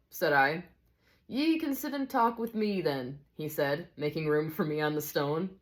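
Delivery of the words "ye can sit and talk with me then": put-on high-pitched old Scotsman; lilting amateur Scots; Scottish teacher. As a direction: invite them in a high Scottish character voice. Voices the teacher in a story.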